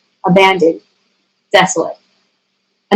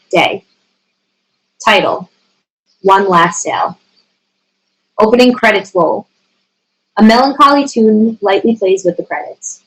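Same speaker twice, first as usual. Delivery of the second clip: ordinary talk, nothing marked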